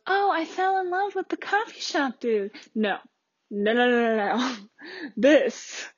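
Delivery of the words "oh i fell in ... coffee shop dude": high, mock-dreamy lovestruck girl; swooning girl parody. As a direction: parody a girl gushing over a barista crush. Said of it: "this is not one of those".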